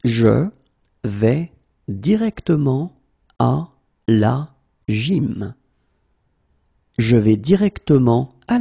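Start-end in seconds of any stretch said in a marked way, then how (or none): 0.1-5.5 à la gym dragged out; slow, broken up. Shown as a demonstration. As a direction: split it into pieces. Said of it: "teacher drills word by word".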